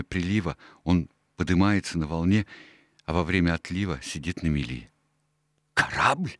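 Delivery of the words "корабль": sharp high surprised question; astonished exclamation. Acted. Doctor hears Jim took the ship, astonished cry. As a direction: gasp out the word in disbelief. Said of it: "воскликнул доктор".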